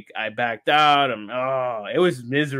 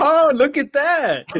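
delighted surprise; 11 semitones higher, 4 dB louder, slower than usual. Real, not acted. Sees "restored" on screen.

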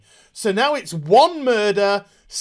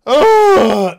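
loud fed-up ugh; disgusted groan; 5 semitones higher, 11 dB louder than usual. Real, not acted. Vlogger groaning in disgust at the finale, loud.

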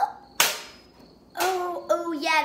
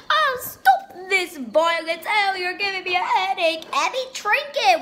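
loud mock complaint; 4 semitones higher, 6 dB louder than usual. Character complaining loudly.

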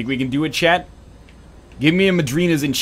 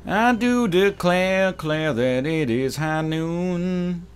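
put-on drawled slowly and smooth, Southern accent; 3 semitones higher, much slower than usual. Streamer redoes the Southern accent slowly, no crack. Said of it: "I don't know what that voice crack was".

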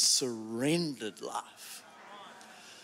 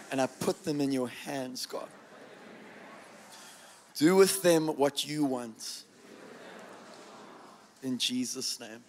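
hushed, reverent prayer for others to repeat; solemn prayer; slower than usual. Real, not acted. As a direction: lead them line by line in surrender. Leads prayer, repeated after him.

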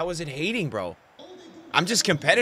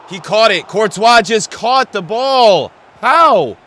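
much louder, higher and slow, outraged yelling; disbelieving rage; 6 semitones higher, 15 dB louder, slower than usual. Real, not acted. Gamer after Courtois saves his penalty, outraged slow yell. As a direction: scream it in sheer disbelief.

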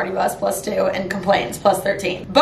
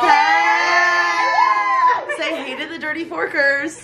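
calling out; 7 semitones higher, slower than usual. Real, not acted. Calling out to the camera.

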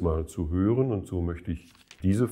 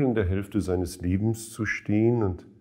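usual speaking voice

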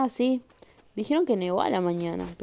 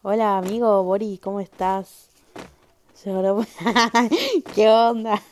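louder, bright hello with a laugh in the middle; loud excited greeting; 2 semitones higher, 9 dB louder than usual. Real, not acted. Streamer hailing a viewer who just arrived, loud and excited. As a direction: greet your friend like you're thrilled he showed up.

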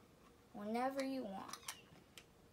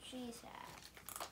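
whispered; 10 dB quieter than usual. Real, not acted. Repeats quietly.

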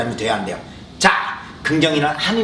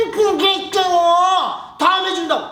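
very slow, high mocking drawl; drawn-out taunt; slower than usual. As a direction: savour the tease. Bully mocking word games.

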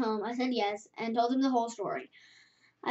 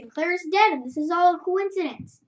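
usual speaking voice

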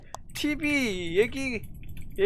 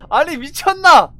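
frustrated yelling; 7 semitones higher, 10 dB louder than usual. Real, not acted. Frustrated yell after fail.

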